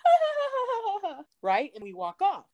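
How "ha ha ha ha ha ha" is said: very high, silly imitation giggle; mocking the girl's laugh.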